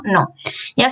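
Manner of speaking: ordinary delivery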